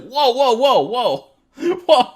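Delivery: loud, high amazed whoas with a laugh; excited whoa. Retold or imitated. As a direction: blown away, laughing with disbelief. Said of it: "and I was like".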